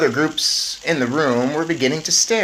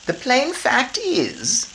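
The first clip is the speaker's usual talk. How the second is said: lightly voiced grandmother; 11 semitones higher, much slower than usual. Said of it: "my grandmother said".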